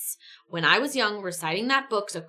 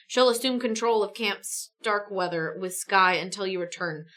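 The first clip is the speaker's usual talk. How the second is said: usual speaking voice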